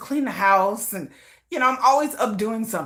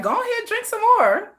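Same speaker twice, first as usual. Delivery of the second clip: coaxing him to drink. Retold or imitated. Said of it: "I'll be like".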